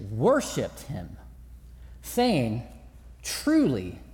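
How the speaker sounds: low, slow, reverent scripture reading. Acted. Pastor reads the disciples' confession to the church, reverent. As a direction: let the words land in awe.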